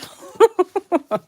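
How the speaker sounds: high, loud peal of laughter; real, rolling giggle; laughing. Real, not acted. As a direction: burst out laughing. Laughs while reading viewer comments.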